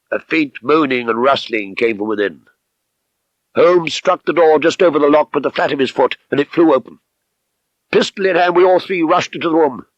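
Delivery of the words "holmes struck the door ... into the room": plain reading voice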